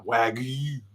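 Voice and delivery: "Wagyu" said deliberately silly, the Y sounded out as "Wagyi"; stupid Wagyu. Shown as a demonstration. Mocking the people who corrected him, silly "Wagyu". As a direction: exaggerate "Wagyu" so it sounds dumb. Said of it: "I'm gonna say it like that".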